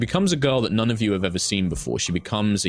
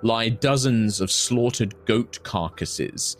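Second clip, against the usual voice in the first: dramatic scene setting; 5 semitones higher than usual. Acted. GM sets a grim scene.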